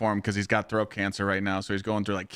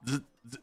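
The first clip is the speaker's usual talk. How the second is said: short soft imitated buzzes; tattoo shader buzz; 3 semitones higher, 8 dB quieter than usual. Client retelling the artist getting the shader out, imitating it. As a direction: buzz softly in separate little bursts.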